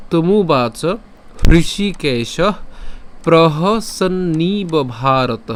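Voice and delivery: slow shloka reading, word parts set apart; broken-up verse recitation. Shown as a demonstration. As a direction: recite it piece by piece, drawn out. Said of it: "ভাঙা অংশটুকু পাঠ করলে".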